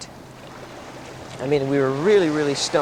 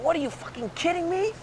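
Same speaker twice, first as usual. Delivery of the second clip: very high disbelieving question; acted incredulity; 13 semitones higher, 3 dB quieter than usual. Teller dragged under bushes, high-pitched incredulous protest.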